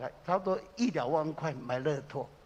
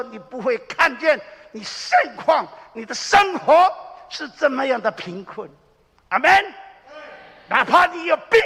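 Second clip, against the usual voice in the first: high, loud, shouted preaching at full force; a pastor crying out the psalm, full-voiced preaching; fervent preaching; 9 semitones higher, 12 dB louder than usual. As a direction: preach it out to the congregation. Preaching with rising fervor.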